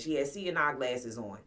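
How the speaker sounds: ordinary talk, nothing marked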